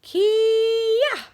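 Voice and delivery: high, loud martial arts kiai shout; fighting yell as the kick lands; spirit scream. Acted. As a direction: blast out a taekwondo battle cry. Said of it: "I scream my spirit scream".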